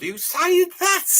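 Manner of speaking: high, slow, affronted "who's saying that"; indignant surprise. Real, not acted. Streamer demanding which chatter said it, indignant. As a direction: pitch up in outrage and stretch it.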